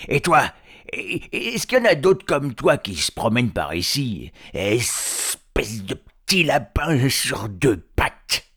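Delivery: loud, high troll interrogation; troll crying out. Acted. Troll questioning the captive hobbit, loud bullying cry. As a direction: shout it, dragging out the final insult. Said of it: "s'écria-t-il".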